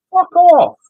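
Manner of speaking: high, loud burst of contempt; scornful outburst. Real, not acted. Reacting to the judge's talk of chambers, scornful shout. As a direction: shout it out, pitch shooting way up.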